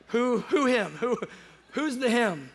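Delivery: loud, high, stammered disbelief; incredulous demand. Retold or imitated. Traveler to seatmate who has seen Him, incredulous. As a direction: blurt it out in astonishment, tripping over the question. Acted shock at her revelation.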